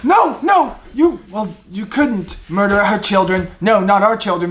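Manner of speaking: high, loud flustered protest; acted panic, starting high and easing slightly; pleading goblin.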